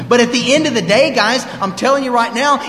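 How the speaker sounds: pulpit-style loud exhortation, slowing right down on horse crap; loud preaching. Real, not acted. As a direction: drive it home to the room, loud and high. Preaching against excuses.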